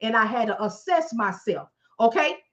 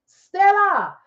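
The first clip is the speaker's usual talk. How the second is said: relishing the name; 8 semitones higher, 6 dB louder than usual. Acted. Naming Stella with relish.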